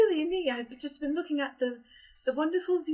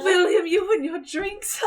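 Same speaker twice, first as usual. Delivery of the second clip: laughing tease; 7 semitones higher, 7 dB louder, slower than usual. Acted. Teasing drunk uncle, laughing.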